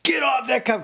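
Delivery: put-on sudden high yell at the kid; retold angry shout. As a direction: snap at the boy to get off. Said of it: "he went nuts".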